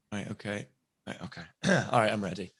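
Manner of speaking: very soft, stop-start muttering; muttered self-preparation. Retold or imitated. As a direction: psych yourself up under your breath. Acts out nervous preparing to read.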